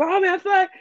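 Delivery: high-pitched mock outburst; madder kid. Kid getting madder at the taunt, high mock anger. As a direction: imitate a kid losing it, voice way up. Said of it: "he got even more mad".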